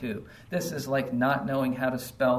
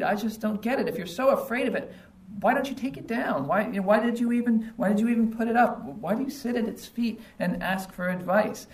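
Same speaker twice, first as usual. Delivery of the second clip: higher-pitched, baffled ridicule; incredulous mockery; 7 semitones higher than usual. Preacher challenging idol worshippers who fear their idol, incredulous. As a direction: question them in high-pitched disbelief.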